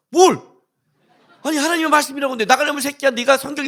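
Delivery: sharp incredulous what, loud and high; a loud indignant what; outraged what. Retold or imitated. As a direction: snap back in disbelief. Pastor's outraged retort.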